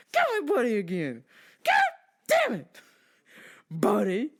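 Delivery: loud furious outburst, cracking high; angry yell. Acted. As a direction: scream it at him. Yelling at the customer.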